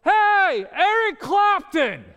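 high, booming holler across a street; yelling. Retold or imitated. Homeless man hailing his friend across the street, imitated yell. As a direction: imitate a big man's loud, drawn-out yell. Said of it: "he yells".

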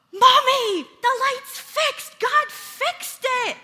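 put-on gasp, then very high, loud toddler shout; acted small-child amazement, squealed high and slow; excited Keegan. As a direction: imitate a thrilled toddler, stretching the words out. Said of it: "so excited".

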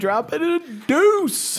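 high, loud, drawn-out joke announcement; gleeful crowing. Real, not acted. Co-host heard the toilet in the background, gleeful crowing. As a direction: crow it slowly, way up high. Joking about a bathroom noise.

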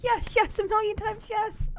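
hopping-up-and-down excited yes; giddy yes. Retold or imitated. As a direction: squeal the yeses like a bride jumping up and down. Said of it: "she hops up and down like".

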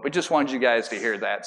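usual speaking voice

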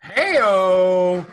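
loud, high party call of "Hey-o"; hype shout. Real, not acted. Cohost cheering the Google search news with a loud hype call. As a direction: whoop it up like a crowd cheer.

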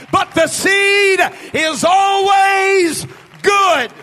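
urgent sermon building to a shout; impassioned preacher rallying the congregation; full-force preaching. Real, not acted.

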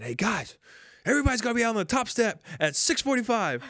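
excited high rallying call; hyped clubhouse announcement; rallying teammates. Retold or imitated. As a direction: pump the team up, fast and loud. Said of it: "And I said".

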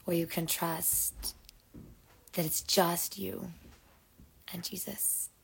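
unhurried meditation voice with long pauses; guided meditation. Acted. Host settles listeners in to breathe and rest, guided meditation.

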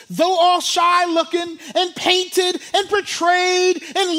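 loud, high-pitched sermon delivery; full-force preaching. Real, not acted. Preacher declaring God's judgment on evil, full force. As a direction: drive it out loud, stretching the key words.

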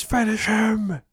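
very high, loud, slow finish him; game announcer yell. Retold or imitated. Cooper screaming for the kill, fighting game style.

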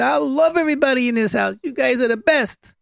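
high, loud and warm-hearted; same loud tone, loving words. Shown as a demonstration. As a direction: shout the love at the same volume. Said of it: "Same tone of voice".

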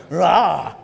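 a short hammed-up war cry; mock war cry. Young soldiers roaring for a fight, mock battle cry. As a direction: bark it like keen young soldiers.

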